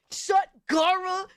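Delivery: high, slow, put-on voice quoting a stranger; mock quote. Streamer mimics a viewer's comment, high and drawn out. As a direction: stretch it out in a high mocking tone. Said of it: "Someone said this to me".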